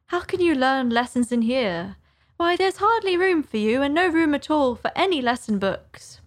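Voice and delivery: lifted pitch, mildly exasperated with herself; Alice self-scolding. Acted. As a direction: argue with yourself as young Alice.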